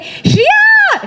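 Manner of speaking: high excited shout of a name; calling out excitedly. Retold or imitated. Children spotting her at the hotel, excited shout. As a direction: kids yelling her name across the room. Kids spot her at breakfast.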